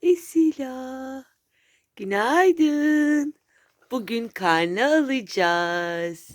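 drawn-out, lilting morning call; sing-song wake-up call. Real, not acted. Mother waking Esila for report card day, sing-song call.

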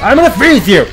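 playful fighting yell; battle shout. Pretend. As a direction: shout it as you attack. Taunting enemy in game.

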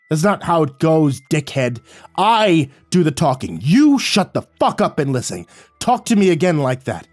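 loud, high threatening shout; panting threat. A narrator's reading. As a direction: bark it out, out of breath and furious. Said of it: "He panted, Shut your mouth".

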